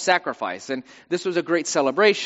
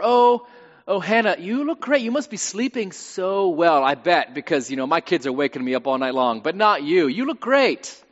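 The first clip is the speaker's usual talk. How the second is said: backhanded compliments; 3 semitones higher, 3 dB louder than usual. Acted. Rival flattering Hannah to provoke her, gushingly fake. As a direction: lay on the sugary flattery, pitch jumping up on the praise. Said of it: "things that are like compliments, but not".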